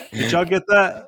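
cracking up at his own joke; laughing. Real, not acted. Host laughing at his own joke to the co-hosts, giggling.

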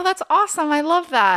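high, loud, thrilled squeal; excited gushing. Retold or imitated. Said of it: "And I was like".

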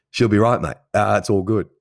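hammed-up Aussie accent. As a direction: boom it out like a cheery Australian mate. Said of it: "that's what the Aussies say".

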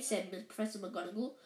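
plain reading voice

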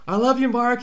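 high-pitched imitation of calling out; retold yell. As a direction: mimic an adoring shout without real volume. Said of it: "my sister-in-law yells".

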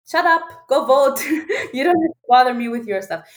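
punchy joke payoff, mock-bossy; dismissive husband. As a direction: play the husband brushing off his wife, laughing midway. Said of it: "now I can tell her".

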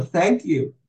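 a light imitation of choking up while saying "Thank you"; retold tearful thanks. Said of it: "I started crying".

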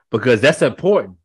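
normal talking voice

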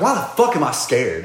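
laughing exasperation at yourself; mock pissed off. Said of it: "sometimes it'd piss me off".

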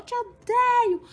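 grito agudo e alto de menina chorando, lento; filha chorando com raiva. Retold or imitated. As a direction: imite a filha gritando entre lágrimas. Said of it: "Ela chorou". (filha adolescente berrando chorosa para a mãe)

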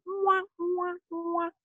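high, soft "wah, wah, wah"; mock sad trombone. Speaker mocking a life going downhill, sad-trombone wah-wahs. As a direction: imitate a little failure jingle on a held note.

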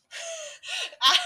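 high bursting laugh; burst of laughter. Real, not acted. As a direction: break into loud laughter.

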